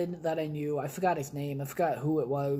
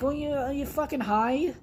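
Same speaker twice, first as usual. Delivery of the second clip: mock disbelief; 9 semitones higher than usual. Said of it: "I'm like".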